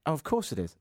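ordinary talk, nothing marked

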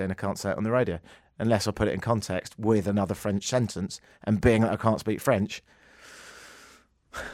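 ordinary delivery

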